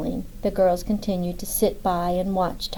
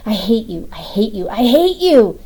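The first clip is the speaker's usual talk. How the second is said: a crying, furious yell, louder on the last; angry yelling; 2 semitones higher, 7 dB louder than usual. A narrator's reading. Woman screaming at the man she blames, furious yelling. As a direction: scream it while pounding his chest. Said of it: "she yelled".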